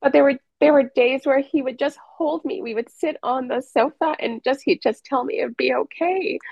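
fighting tears while talking; emotional. Real, not acted. Remembering husband holding her, voice choked up with emotion. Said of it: "I'm sorry, I'm getting all emotional".

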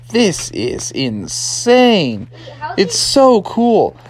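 very slow, stretched-out wonder; slow, hammed-up awe; awed marvelling. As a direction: draw out each word in amazement. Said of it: "they were like".

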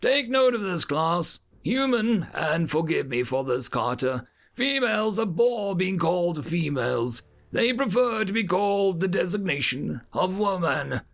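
high-pitched instructor addressing cadets; lecturing educator. A narrator's reading.

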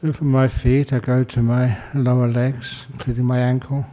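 calm meditation guidance with long pauses; guided meditation. Acted. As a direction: guide them unhurriedly into stillness, pausing between phrases.

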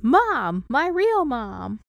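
high, loud, drawn-out mock cry; hammed-up, swooning exclamation; overcome cry. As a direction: call out dramatically to a long-lost mother. Acts out Jack's cry to his mother.

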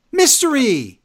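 loud, high dramatic exclamation; hyped mock reveal. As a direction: shout it like a big story twist. Hyped reveal of pitched storyline.

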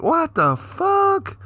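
high, long-drawn incredulous question; hammed-up bewilderment; acted disbelief. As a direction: stretch it out high in baffled protest. Retold reaction to false accusation.

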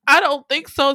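high, loud sassy comeback; defiant refusal. Retold or imitated. Dog refuses to let cats near baby, high mock defiance.

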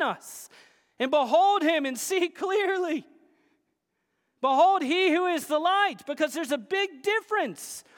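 high, emphatic pulpit delivery; impassioned preaching. Acted. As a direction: proclaim it to the congregation. Preaches with raised voice.

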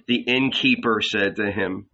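ordinary delivery

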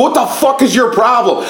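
high, heated challenge; retold hot anger. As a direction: re-enact an angry confrontation. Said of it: "I was so fucking hot".

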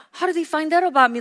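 high, loud, quick mock-shocked voice. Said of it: "then they get shocked".

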